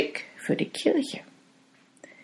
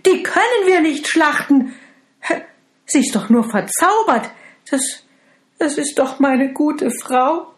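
higher, louder anxious protest; pleading husband; 9 semitones higher, 6 dB louder than usual. Acted. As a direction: beg to spare his enchanted wife.

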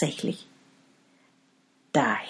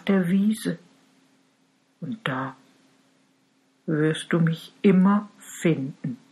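tender cow farewell. Acted. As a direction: give her last instructions gently.

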